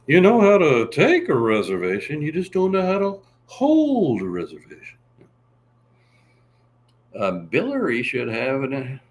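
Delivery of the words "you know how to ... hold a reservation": sitcom impression. Seinfeld impression continued.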